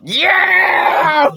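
high, loud victory yell; shouted whoop into squealing laugh; triumphant shout. Real, not acted. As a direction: yell it like you just won. Committing to trivia answer.

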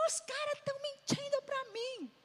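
high-pitched mock outraged whine; angry complaint, imitated. Wife phoning Marcos about being lied to, high indignant complaint. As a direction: act out an indignant tattling wail. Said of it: "Fiquei tão brava, irmão".

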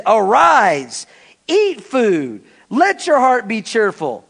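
bossy commanding tone; Jezebel's tone. Acted. Jezebel ordering her pouting husband, bossy. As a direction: order him up like a servant. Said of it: "Jezebel had a tone".

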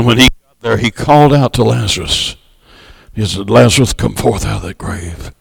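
low, soft storytelling voice that draws the congregation in; hushed sermon storytelling. Acted. Preacher hushing the congregation for the Lazarus story, low and soft. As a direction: tell the miracle in a low, intimate murmur.

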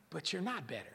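usual speaking voice

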